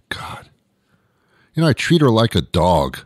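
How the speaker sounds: normal talking voice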